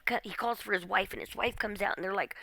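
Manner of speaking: ordinary talk, nothing marked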